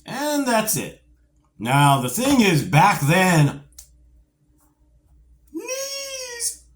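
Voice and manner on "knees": "knees." burst out much higher, like a squeal; excited shout. Real, not acted. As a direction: shout "knees." as the big reveal. Big deal back then.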